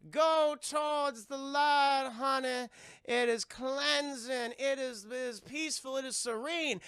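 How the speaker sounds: high, slow, drawn-out spirit-guide voice; Zelda the medium. Retold or imitated. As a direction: intone it slowly like a mystic guide. Zelda guides mother to light.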